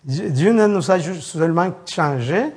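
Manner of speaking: normal talking voice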